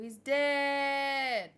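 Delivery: last word held long, hammed-up; mock dramatic cry. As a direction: stretch "dead" out in a mock wail. Reads Piccolo's panicked yell from meme.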